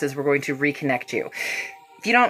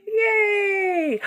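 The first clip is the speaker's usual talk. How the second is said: excited cheer. Real, not acted. Host cheers at viewers hitting the gift goal, squealing. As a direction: squeal with delight.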